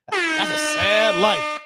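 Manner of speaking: drawn out slowly, mock lament; mock mournful. Mourning a viewer's Cowboys fandom, drawn-out mock sorrow. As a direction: stretch it like a sad ballad.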